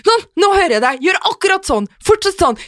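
high, loud and hurried, don't move; suddenly hear them and beg them to hold still; urgent high instructing. Retold or imitated. Partner on the phone urging her to stay put, high and urgent.

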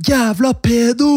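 high, slow mock yell from a distance; retold shout. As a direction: yell it like an angry kid across the garden. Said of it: "hører jeg noen rope sånn". (hånlig rop fra gata)

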